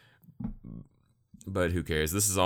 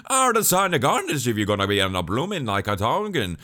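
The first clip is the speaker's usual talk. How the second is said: put-on loud, high garbled Irish-Scottish accent; mock Irish-Scottish brogue, near gibberish; Gene; 6 semitones higher, 7 dB louder than usual. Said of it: "whoever's doing this is like".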